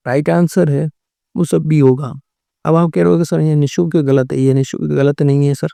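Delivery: normal talking voice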